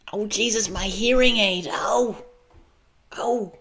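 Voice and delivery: startled, high-pitched complaint; high yelp. Real, not acted. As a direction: jump way up in pitch, as if caught out. Reacting to hearing aid trouble.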